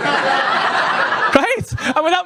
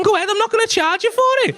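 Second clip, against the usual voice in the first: insistent dealer; 10 semitones higher, faster than usual. Retold or imitated. Driver insisting, acted quote.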